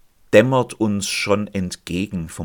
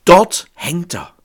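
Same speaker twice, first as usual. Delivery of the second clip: ordinary delivery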